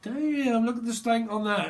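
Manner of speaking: hammed-up blokes leering at a woman; guys ogling. Guys at the bar eyeing a woman, mock catcall. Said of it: "the guys are like".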